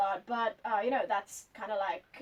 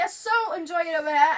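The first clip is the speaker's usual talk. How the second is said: louder, clearly British take; British accent; 5 semitones higher, 6 dB louder than usual. Shown as a demonstration. Same line again in British English for the host. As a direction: say it the British way with a lift on so. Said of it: "Uh, American".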